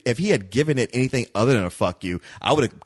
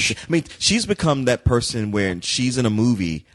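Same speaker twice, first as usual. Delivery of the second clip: usual speaking voice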